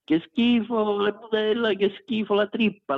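high mock disgust, squealing "che schifo".

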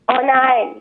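mild mock wail; lightly acted moan of protest; whining. A narrator's reading. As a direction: lament it like a put-upon mother. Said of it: "jammerte Mama".

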